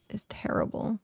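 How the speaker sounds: whispered aside about bad luck; whispering. Real, not acted. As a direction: drop to a whisper on it. Confides bad luck in game.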